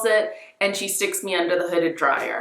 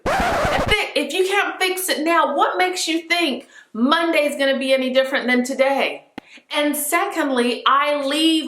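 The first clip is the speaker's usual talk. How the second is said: retold outrage; 7 semitones higher than usual.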